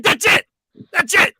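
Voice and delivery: high, loud cut-off; host cutting caller off. Retold or imitated. Radio host cutting off a caller. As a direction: shout him down to end the call. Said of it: "He's like".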